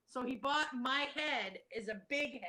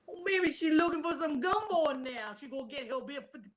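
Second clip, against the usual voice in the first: Cajun; 3 semitones higher, 5 dB louder than usual. Acted. Co-host joking about gumbo, hammed-up Cajun. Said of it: "Oh, that's Cajun".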